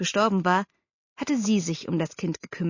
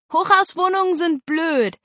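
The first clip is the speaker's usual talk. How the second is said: put-on high, louder child's protest; grumbling girl; 10 semitones higher, 6 dB louder than usual. A narrator's reading. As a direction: blurt it out like a cross child. Girl voiced, complaining. (trotziges Mädchen, hohe Stimme)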